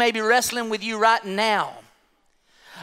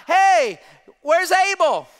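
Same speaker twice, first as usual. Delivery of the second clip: raised, high-pitched call; 7 semitones higher, 5 dB louder, slower than usual. Acted. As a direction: call across a distance for someone missing. Voices God calling out to Cain.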